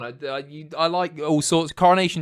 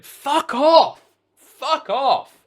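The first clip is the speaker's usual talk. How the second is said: high, loud outburst; shouted retort; 13 semitones higher, 9 dB louder, much slower than usual. Real, not acted. Streamer rejecting the cider joke, shouted outraged retort. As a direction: yell it twice, each a big burst.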